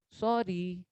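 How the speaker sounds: Sorry said soft and low; a soft humble sorry; gentle sorry. Shown as a demonstration. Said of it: "tone का फर्क है". (Sorry धीमे, नरम लहजे में)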